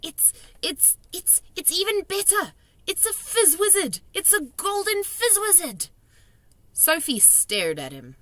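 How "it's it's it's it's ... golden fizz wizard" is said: high, stammering rising delight; thrilled. A narrator's reading. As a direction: stumble over the words with joy. Giant overjoyed at catch.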